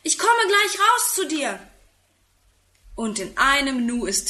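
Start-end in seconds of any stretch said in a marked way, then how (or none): 0.0-1.6 put-on high boy's call from the window; raised, high-pitched child's call. A narrator's reading. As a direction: call down to a friend outside. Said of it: "ruft er aus dem Fenster".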